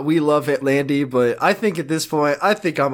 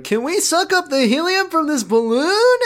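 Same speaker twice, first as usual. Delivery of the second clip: high-pitched mock kid asking; pleading kids' voice; 12 semitones higher than usual. Josh and Andy asking Amanda for helium, high eager voice. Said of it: "Josh and Andy are like".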